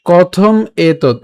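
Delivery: কথম এতৎ slowly, as two separate words; split words. Shown as a demonstration. Teacher separates the e vowel, slow split কথম এতৎ. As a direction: close কথম on m, then start এতৎ afresh. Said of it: "সেই একারটাকে আলাদা করে".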